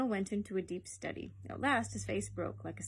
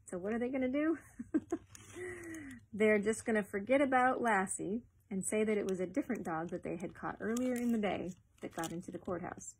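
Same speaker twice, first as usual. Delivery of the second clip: ordinary delivery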